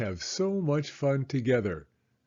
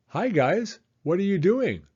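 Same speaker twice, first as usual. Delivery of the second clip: friendly greeting; 7 semitones higher, 3 dB louder than usual. Acted. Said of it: "friend Sam stopped by".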